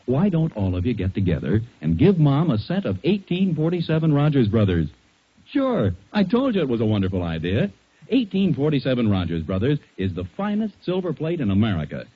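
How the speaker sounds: ordinary talk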